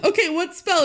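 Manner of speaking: a hint of a bubbly little sister asking; quoting the sister with a laugh; mock eager sister. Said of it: "she's like".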